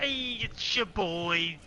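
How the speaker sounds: slow drawn-out mock YouTuber hello; YouTuber greeting. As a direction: lightly imitate a gamer intro. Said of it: "Be like".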